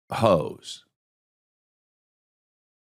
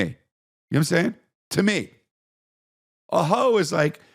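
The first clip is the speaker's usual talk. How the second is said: ordinary talk, nothing marked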